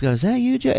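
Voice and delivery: high calling voice; Robin calling out. Retold or imitated. Comedian on stage calls high to a man in the crowd. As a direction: call it up high toward the back. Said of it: "so Robin goes".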